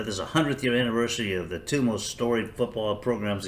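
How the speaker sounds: ordinary delivery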